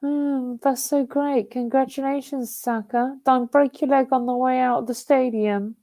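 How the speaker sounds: high, soft mock-sweet voice; sarcastic congratulations. Real, not acted. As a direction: congratulate sweetly while meaning the opposite. Sarcastic congratulations to rival player.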